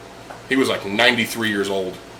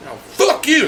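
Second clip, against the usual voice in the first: squeaky curse; slower than usual. Retold or imitated. Old man curses bartender.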